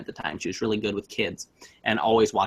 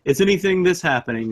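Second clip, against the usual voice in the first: mock spooky questions; 5 semitones higher, 3 dB louder than usual. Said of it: "we're kinda goofing with each other".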